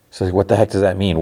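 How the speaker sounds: normal talking voice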